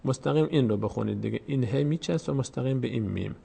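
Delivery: ordinary talk, nothing marked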